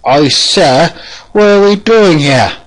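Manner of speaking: high, loud put-on voice; questioning pupil character. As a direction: pipe up high as the puzzled pupil. Plays questioning pupil to a master.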